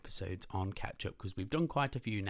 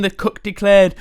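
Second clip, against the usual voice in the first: lively, loud read-aloud storytelling; animated picture-book reading; story narration; 8 semitones higher, 17 dB louder, slower than usual. A narrator's reading. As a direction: read it big and bouncy for kids.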